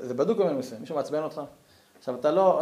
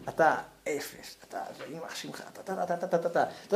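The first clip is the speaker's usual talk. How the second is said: muttered insults; 9 dB quieter than usual. Acted. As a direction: hiss the insults under your breath.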